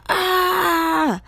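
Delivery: high, loud scream; long high yell on being struck; pained yell. A narrator's reading. Medusa is struck by Grover, yelling high. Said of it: "Medusa yelled".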